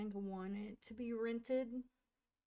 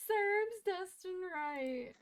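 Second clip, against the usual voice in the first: much higher, slow, louder stunned repeat; amazed; 9 semitones higher, 6 dB louder, slower than usual. Real, not acted. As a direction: draw it out in giddy shock. Repeating in shock, laughing.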